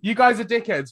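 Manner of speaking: loud teasing. Real, not acted. Teasing Australian friends over their laughter, high and playful. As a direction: repeat the insult brightly, all in jest.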